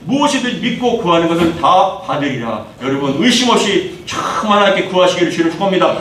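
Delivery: ordinary talk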